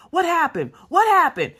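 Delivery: high-pitched, insistent questioning; interrogating husband. Retold or imitated. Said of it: "he's, like, asking her".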